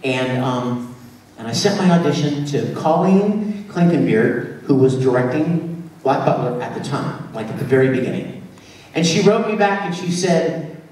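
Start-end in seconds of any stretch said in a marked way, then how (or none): none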